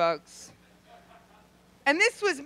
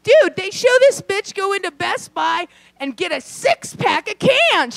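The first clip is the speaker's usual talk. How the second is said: high, louder, worked-up telling; excited; 8 semitones higher, 7 dB louder than usual. Real, not acted. Comic tells the audience about a TV show, excited and loud. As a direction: launch into it all fired up.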